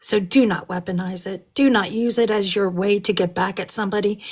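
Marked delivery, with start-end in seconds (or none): none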